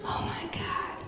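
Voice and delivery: hushed breathy sigh of words; exasperated whisper about the parents; whispered exasperation. Real, not acted. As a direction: whisper it in disbelief. Exasperated aside.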